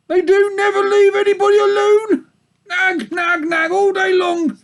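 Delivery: very high, loud nagging sheep voice; old ewe griping about the wolves; complaining old ewe. Acted. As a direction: grumble on like a fed-up old ewe. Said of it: "hoarse, complaining voice".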